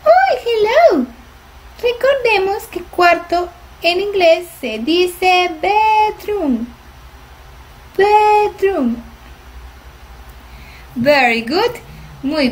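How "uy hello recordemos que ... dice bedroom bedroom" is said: high squeaky puppet voice; puppet Mila voice. Pretend. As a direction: speak as the little helper puppet. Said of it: "¿Cómo se dice cuarto en inglés, Mila?". (voz aguda de títere Mila)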